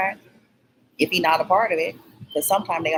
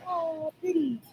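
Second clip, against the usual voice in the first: admiring coo; 12 semitones higher, 6 dB quieter, slower than usual. Retold or imitated. Admiring the butterfly.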